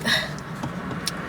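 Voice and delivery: long quiet sigh out; heavy sigh. Real, not acted. Thinking of her mama, a heavy tired sigh. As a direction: let out a weary sigh.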